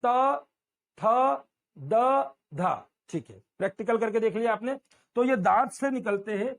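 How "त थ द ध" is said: त थ द ध said twice, tongue on the teeth, loud and crisp; model tooth sounds. Shown as a demonstration. Teacher introduces the tooth row: त थ द ध, crisp. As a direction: drill त, थ, द, ध with a sharp tap on the teeth. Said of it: "ध्यान दीजिए कि हमारी जीभ दांत से लग रही है".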